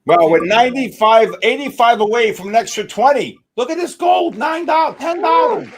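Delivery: loud, high excited shouting. Real, not acted. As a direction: yell out the gains as they climb. Watching gold profits climb.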